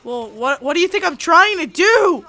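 high, loud exasperated shout at daughter; frustrated yell. Acted. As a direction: yell it out, fed up with the game. Frustrated mom yelling at daughter.

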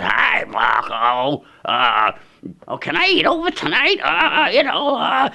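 put-on high, slow, drawn-out old lady voice; hillbilly neighbor lady. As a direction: ask sweetly in a high hillbilly granny voice.

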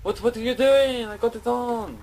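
high, loud "what are you doing?"; alarmed outburst; startled shout. Real, not acted. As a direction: yell across the room at a friend. Yelling at Phil mid-game.

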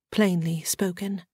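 ordinary delivery

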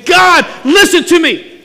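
high, loud cry to God; a shouted, earnest plea. Shown as a demonstration. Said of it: "earnestly say".

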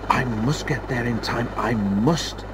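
softly voiced touch of worry; understated urgency, kept soft; fretting a little under the breath; mildly anxious. A narrator's reading. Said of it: "he whirred anxiously".

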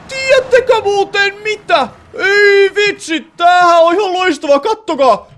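high, loud thrilled shouting, slow on the first words; excited shout. Real, not acted. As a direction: yell out the joy of a lucky find. Finds amazing spawn spot. (innostunut huuto)

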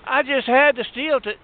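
pleading mother. Acted. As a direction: play the sob story back up, pitched high. Shoplifter resumes her plea.

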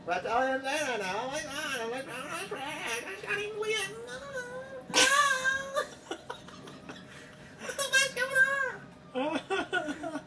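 climbing into a high squeal; mock giddy disbelief. Driver finding herself on a track, rising mock hysteria. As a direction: let it rise into squeaky excitement. Driver getting overexcited in the bit.

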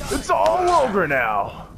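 "ogre" stretched high and slow; drawn-out shout. Shown as a demonstration. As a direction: bellow it like a triumphant battle cry. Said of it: "repeats catchphrase in new ways".

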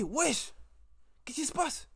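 sudden high, loud exclamation; startled outburst. Real, not acted. Vlogger startled mid-video, loud surprised cry. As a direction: jump at something unexpected.

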